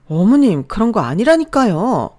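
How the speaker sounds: 크게 소리치며 억울함을 호소하듯; 목청 높여 결백을 외치듯; 억울한 항변. Acted. 억울한 며느리가 항변함. (며느리가 시어머니에게 크게 소리치며 항변)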